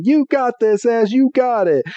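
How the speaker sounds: high encouraging cheer; cheering Pokemon. Retold or imitated. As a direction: cheer him on like a little sidekick. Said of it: "in the background going".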